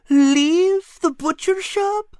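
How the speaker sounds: a greedy cat who can't believe it; shocked cat. Acted. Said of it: "he mumbled".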